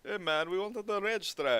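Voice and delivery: a hint of dad's put-on Jamaican accent; lightly mock a Jamaican accent; imitating dad's Jamaican accent. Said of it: "talked like he was in Jamaica".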